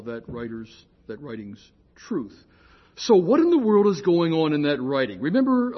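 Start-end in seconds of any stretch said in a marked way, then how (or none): none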